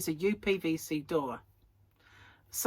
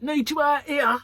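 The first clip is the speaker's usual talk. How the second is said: imitating Gary, loud but a bit less; urgent call; 7 semitones higher, 7 dB louder than usual. Husband calling wife out of filming, loud call. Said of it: "Or like".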